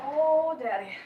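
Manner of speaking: high, loud, swooning outburst; loud high "oh", then easing down; delighted exclamation. Real, not acted. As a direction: exclaim over the food, pitch way up. Exclaims over the food.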